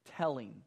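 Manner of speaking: "Telling." said alone, firm with a falling end; emphasized word. Shown as a demonstration. Preacher singles out the word for the congregation, firm and falling. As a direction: set "Telling." apart as the key word. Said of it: "let me emphasize a different word".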